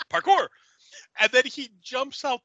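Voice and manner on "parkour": high, loud mock shout of parkour; mock yell. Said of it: "He goes".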